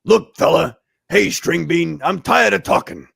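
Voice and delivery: loud gruff Thing voice. Acted. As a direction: growl it like a rocky brawler. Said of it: "the best thing voice".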